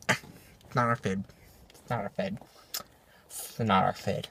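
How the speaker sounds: low, very soft mock grumble; hushed, low imitation of disappointment; sulky mimicry. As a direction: mutter it like a sulking customer. Mimics customers sulking at passing food.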